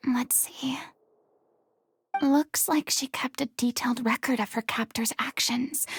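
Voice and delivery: murmured under the breath while reading; whispered. Acted. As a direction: hush it as you scan the page. Quiet reading, unvoiced.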